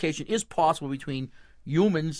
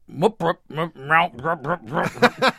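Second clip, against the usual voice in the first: cat sounds; slower than usual. Shown as a demonstration. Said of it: "she tells you how to speak cat".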